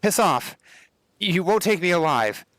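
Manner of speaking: put-on high, shouted young man's voice; boyish voice. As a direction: yell it like a cornered boy. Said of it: "A high, boyish voice shouts out".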